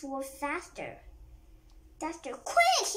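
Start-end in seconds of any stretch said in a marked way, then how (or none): 2.3-2.9 high, loud urgent shout; yell. A narrator's reading. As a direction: shout it out sharply to hurry friends. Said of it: "yelled Chip".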